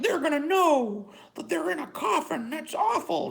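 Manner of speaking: high, prissy mock-concerned voice; mocking worried critic. Mocking critics who fret about the coffin. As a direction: whine it like a pearl-clutching critic. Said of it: "All this shit like".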